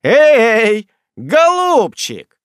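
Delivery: very loud, high, drawn-out hail. A narrator's reading. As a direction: bellow it to make him turn. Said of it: "более громким окриком".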